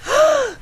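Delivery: short high gasp of surprise; startled high intake of breath; sharp gasp. Acted. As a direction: gasp in shock. Gasp at finding pills.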